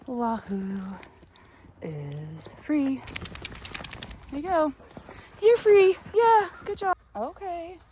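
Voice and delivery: very high coaxing sing-song; pet baby talk. Acted. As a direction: call the hens over sweetly and brightly. Coaxes hens over.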